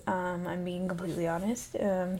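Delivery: ordinary talk, nothing marked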